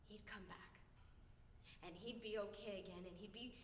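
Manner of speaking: low, very soft, halting; hushed remembering. Acted. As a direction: let the voice sink as hope returns. Acted recalling father's brief recoveries.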